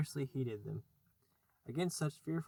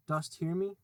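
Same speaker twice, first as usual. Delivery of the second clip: a little louder and higher call; raised voice; 4 semitones higher, 4 dB louder than usual. Acted. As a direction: call out with a quiver. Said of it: "Hook raised his voice".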